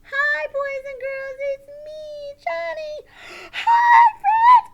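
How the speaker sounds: very high, loud puppet greeting; shouted squeaky puppet hello; excited puppet. Pretend.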